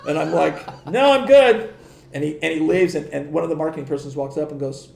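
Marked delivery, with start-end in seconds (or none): 0.9-1.7 high, loud brush-off; comic, hasty no-thanks; retold alarmed refusal. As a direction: turn the stranger down in a raised, higher voice. Retold hasty refusal to odd stranger.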